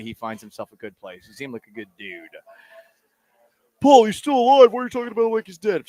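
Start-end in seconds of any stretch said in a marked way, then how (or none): 3.8-5.9 high, loud mock protest, rushing the second line; imagined listener. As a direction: play a caller pushing back at the host. Imagined listener objecting to host.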